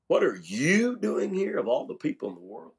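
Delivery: single out the word you; YOU lifted high, as if picked from everyone; stress on YOU. Shown as a demonstration. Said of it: "put an inflection on a different word".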